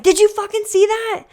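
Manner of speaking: high, incredulous outrage at the judge; exasperated outburst. Retold or imitated. Racer to the judge after a missed spear, outraged high disbelief. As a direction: demand it like you can't believe it. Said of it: "I literally looked at the guy and I was like".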